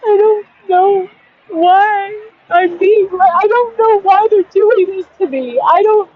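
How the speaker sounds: high, strained, near tears; real distress, the voice cracking as it climbs; voice breaking. Real, not acted. Venting to viewers about being homeless, voice breaking up. Despair at the end of the rant.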